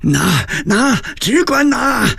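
high, strained repeated cries; frenzied yelling. A narrator's reading. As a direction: screech the order in desperation. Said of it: "拧着脖子狂叫道". (拧着脖子高声狂叫)

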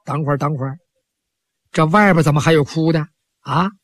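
put-on an old man stopping to listen; puzzled old priest. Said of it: "老道一听".

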